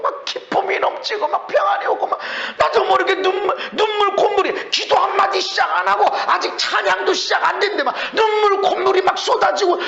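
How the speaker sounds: high, loud, rising sermon full of wonder; fervent preaching. Acted. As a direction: preach it with building joy and tears. Preaching at full force, rising excitement.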